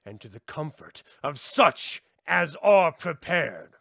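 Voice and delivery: loud, rising declamation of an occult invocation; ritual invocation. Acted. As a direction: call out the verse like a high priest.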